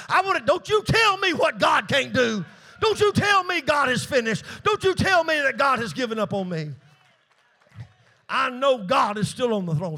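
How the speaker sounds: loud and high, pushing back hard; defiant shout. Real, not acted. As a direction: refuse to hear God is finished. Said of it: "preacher defiant outburst".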